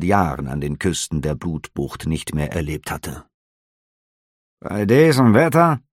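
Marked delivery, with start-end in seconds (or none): 4.6-5.8 growled. A narrator's reading. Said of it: "knurrte der Offizier".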